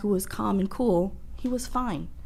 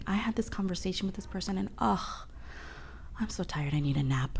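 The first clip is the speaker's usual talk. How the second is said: tired, whispered, much softer; drained whisper; 7 dB quieter than usual. Retold or imitated. After draining company, whispering she needs a nap. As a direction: sound wiped out, sighing it away. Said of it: "I'm, like, mentally drained".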